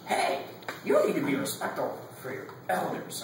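high-pitched crabby elder impression; cranky old person. Old church person scolding a youngster, cranky. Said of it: "they always have the cranky voices".

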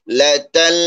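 লা তাল with the final l held on; ta catching lam. Shown as a demonstration. Teacher shows ta joining lam, লা তাল with sustained final l. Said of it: "তা গিয়ে লামকে ধরছে".

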